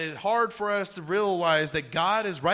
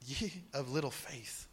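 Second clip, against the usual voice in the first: much softer, hushed reproach; gentle rebuke; 6 semitones lower, 12 dB quieter than usual. Retold or imitated. Jesus answering the frightened disciples, soft and hushed. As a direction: chide them tenderly under your breath. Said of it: "what is he gonna say".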